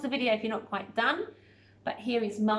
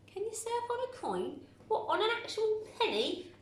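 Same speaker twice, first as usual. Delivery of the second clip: much higher, disbelieving lift; incredulous question; 11 semitones higher, 4 dB quieter than usual. Real, not acted. Teacher to pupils, amused disbelief at the sentence. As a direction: ask it as if it's absurd.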